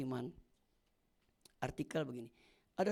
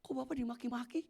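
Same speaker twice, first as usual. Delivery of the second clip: puzzled passenger; 6 semitones higher than usual. Retold or imitated. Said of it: "penumpangnya bingung".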